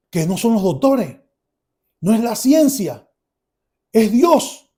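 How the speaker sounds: rising to high, loud declaration; emphatic proclamation. Retold or imitated. Son concludes to father it is God, emphatic. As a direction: build phrase by phrase with deliberate pauses. Testimony climax declaring God's power.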